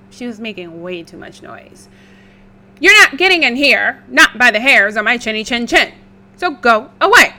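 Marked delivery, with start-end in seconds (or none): 2.8-7.3 defiant pig voice. Acted.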